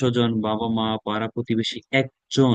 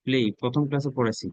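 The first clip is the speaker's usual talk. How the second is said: normal talking voice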